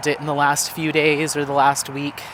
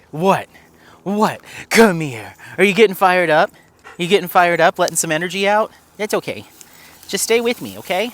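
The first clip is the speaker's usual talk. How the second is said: higher, louder soothing of the dog; calm the excited dog, upbeat; talking to the dog; 4 semitones higher, 4 dB louder than usual. Real, not acted. Calling and calming excited dog.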